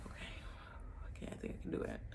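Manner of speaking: unvoiced whisper, talking yourself into it; hushed nervous reassurance; whispered self-talk. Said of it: "And I was like".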